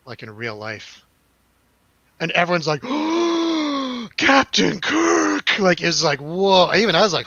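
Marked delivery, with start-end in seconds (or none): 2.9-4.1 long, high awestruck gasp; mock crowd gasp. Crowd reacts to Shatner appearing. 4.2-5.6 high, loud, drawn-out mock amazement; starstruck crowd cry.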